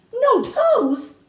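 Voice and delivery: high, loud cry of disbelief; boy blurts out in shock; a boy crying out at grandmother's strange news. A narrator's reading. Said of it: "I cried".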